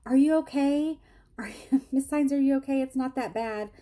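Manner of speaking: high-pitched imitation of a worried helper; concerned clinic worker. Mammographer to crying patient, high concerned questioning. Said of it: "And she's like".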